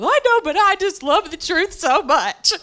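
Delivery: high, tearful comic wail; hammed-up crying protest; mock squalling. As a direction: blubber it as an exaggerated retelling. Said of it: "And I'm just squalling".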